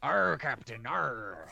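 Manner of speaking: lightly voiced pirate "arr". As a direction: growl it like a deckhand. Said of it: "pirate voice".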